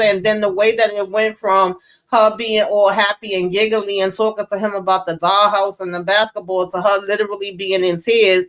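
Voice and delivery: ordinary talk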